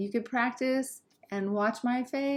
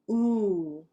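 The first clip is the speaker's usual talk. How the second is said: lips rounded; much slower than usual. Shown as a demonstration. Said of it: "Round your lips".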